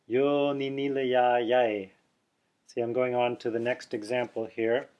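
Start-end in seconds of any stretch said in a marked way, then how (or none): 0.1-1.9 slow, careful Sanskrit syllables "Yo ni ni le ya yai"; Sanskrit recitation. Shown as a demonstration. Teacher reciting the Sanskrit example slowly.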